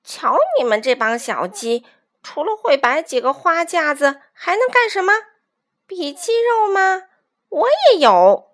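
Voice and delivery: high, jeering taunt; mocking. A narrator's reading. Weasel jeering at a bunch of chicks, mocking. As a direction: sneer at them, stretching the last boast loud. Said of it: "便嘲讽道".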